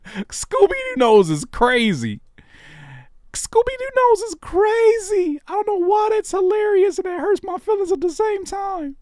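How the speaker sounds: very high, slow squeaky cartoon dog voice. Mimicking a cartoon voice.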